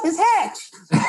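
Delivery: squeaky high-pitched greeting, voice jumping way up; nervous voice breaking up into falsetto; voice cracking high. Retold or imitated. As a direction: starstruck squeak, voice shooting up high. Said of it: "my voice went so high".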